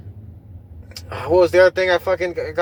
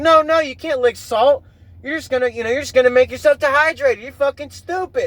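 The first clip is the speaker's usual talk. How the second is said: mock scolding; 6 semitones higher than usual. Mocking someone scolding him.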